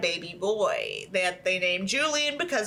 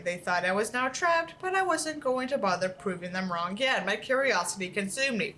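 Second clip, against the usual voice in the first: steady storytelling read; story narration; 4 semitones higher than usual. Acted. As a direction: read the tale to the audience. Reads a horror story aloud.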